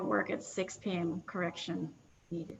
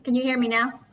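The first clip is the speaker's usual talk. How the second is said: much louder, projecting to be heard; speaking up; 4 semitones higher, 12 dB louder, faster than usual. Real, not acted. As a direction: raise your voice so the room hears. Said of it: "Oh, much better".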